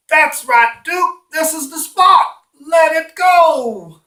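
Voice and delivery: high, loud, drawn-out coaxing; encouraging dog owner. Acted. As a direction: cheer the dog on like a doting daddy. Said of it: "he encouraged the dog to take a poop".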